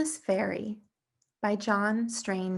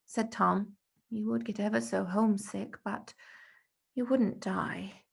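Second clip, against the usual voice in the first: usual speaking voice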